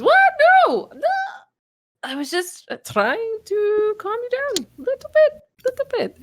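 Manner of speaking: very high-pitched flustered denial; Viola. Acted. Game master as a character excusing her failed charm, high defensive squeak. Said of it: "And Viola just goes".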